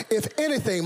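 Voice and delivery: ordinary talk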